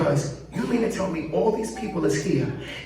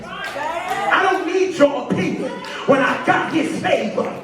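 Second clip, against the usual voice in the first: slow preaching build; 7 semitones higher, slower than usual. Real, not acted. Preacher rising on defiant line.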